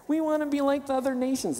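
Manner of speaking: whiny, high-pitched imitation of complainers. Preacher mimicking Israel begging for a king, whiny. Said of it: "Get the whiny tone in my voice".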